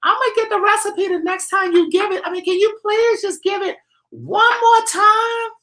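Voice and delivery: high falsetto begging voice; mock Lori Jeter. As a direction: pitch way up, plead for the recipe. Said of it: "Lori Jeter said the other day".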